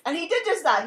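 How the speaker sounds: ordinary delivery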